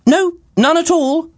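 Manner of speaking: very high, eager reassurance; keen lad promising the world; eager young fellow. Acted. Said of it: "answered Splidge".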